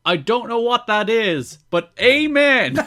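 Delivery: high, loud, drawn-out commentator cry; Don West. Retold or imitated. Host imitating a wrestling commentator's outburst, excited and hammy. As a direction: build from puzzled to a big slow shout. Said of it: "Don West says on commentary".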